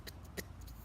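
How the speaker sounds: soft unvoiced spitting sounds; cat spitting. Cat spitting in the wild wind. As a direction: spit it out like a cross cat. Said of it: "how the cat spat".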